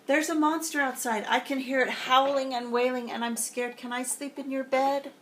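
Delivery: high, frightened cub voice; Baby Bear begging Papa to share his bed; scared little bear. Acted.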